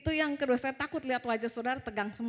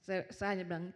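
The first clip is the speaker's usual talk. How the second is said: ordinary delivery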